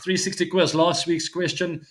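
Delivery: ordinary talk, nothing marked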